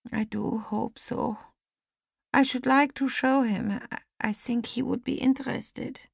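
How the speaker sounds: a hint of a frail elderly lady; lightly voiced old lady. A narrator's reading. Lizzie hoping Carl visits.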